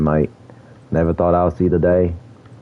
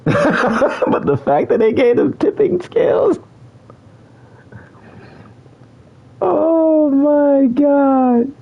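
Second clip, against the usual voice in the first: high, laughing, with a long drawn-out oh my God; cracking up in disbelief at a bad reward; much slower than usual. Real, not acted. Gamer laughing at a weak reward, high and drawn out.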